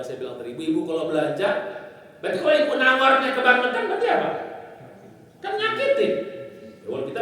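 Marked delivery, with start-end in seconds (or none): 2.2-6.1 pitched way up in mock outrage; a preacher voicing a haggler's complaint, high; mock haggling. Preacher imitating a shocked seller, voice jumping high.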